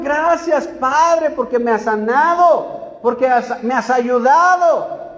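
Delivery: loud, high, impassioned sermon voice; fiery preaching. Acted. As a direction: preach it full force to the congregation. Preaches at full force. (predicación a todo pulmón, fuerte y aguda)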